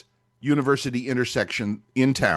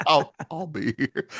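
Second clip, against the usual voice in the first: deadpan; 4 semitones higher than usual. Retold or imitated. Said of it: "with a deadpan straight face".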